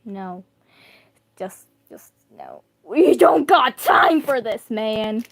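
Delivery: dismissive groan then a loud slow outburst; exasperated no. Retold or imitated. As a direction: brush it off, then snap that there's no time. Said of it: "it wasn't even no. It was just like".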